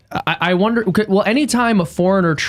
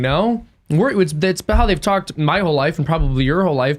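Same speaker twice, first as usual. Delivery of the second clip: ordinary delivery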